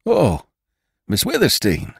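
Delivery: startled rider crying out; excited exclamation. A narrator's reading. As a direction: blurt it out, overwhelmed. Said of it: "ejaculated the rider".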